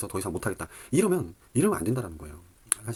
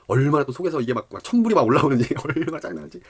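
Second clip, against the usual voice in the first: seething frustration; 5 semitones higher, 8 dB louder than usual. Real, not acted. Phone dying mid-mission.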